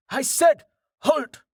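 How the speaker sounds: very high-pitched yelled command. A narrator's reading. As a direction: yell it high, insisting on being obeyed. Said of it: "the man yelled".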